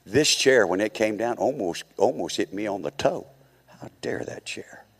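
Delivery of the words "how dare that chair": hushed mock outrage; mock indignant whisper. Preacher mocking offence at the chair that nearly hit his toe, indignant whisper.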